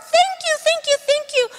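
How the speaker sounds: high, bright little dog voice; puppy puppet. Acted. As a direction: play the eager puppy.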